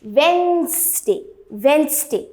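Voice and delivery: "Wednesday" as wenz-day; correct pronunciation demo. Shown as a demonstration. Teacher repeating the corrected word, correct pronunciation demo. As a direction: hum through the n and z of "Wednesday", no first d. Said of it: "the correct pronunciation becomes".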